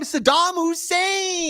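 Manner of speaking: stretched hype introduction; mock announcer. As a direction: call him out like a ring announcer.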